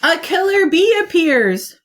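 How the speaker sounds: ordinary delivery, nothing marked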